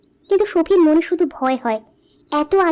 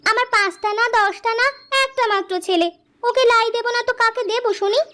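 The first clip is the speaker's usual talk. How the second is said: higher, protesting wife voice; indignant doting wife; 6 semitones higher than usual. Acted. Wife defends spoiling her only son, indignant. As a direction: answer back in defence of your boy, pitched up.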